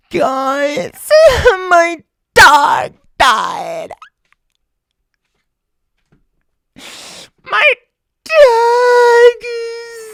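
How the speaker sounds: hammed-up, high wailing over a dead dog; mock sobs, loud slow wails, words drawn out; exaggerated crying. Mocking influencers who cry to camera, hammed-up crying. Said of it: "this is how it looks".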